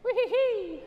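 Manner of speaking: high "wee-hee-hee" squeal; playful whee. Pretend. Playful cry during the demonstration.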